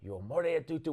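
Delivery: mild imitation of a preacher's fervent "Io amore a tutu"; pastor praying in tongues. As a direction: intone "Io amore a tutu" as the pastor laying on hands. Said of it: "in the middle of him praying in tongues over me".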